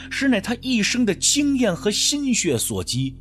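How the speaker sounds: ordinary delivery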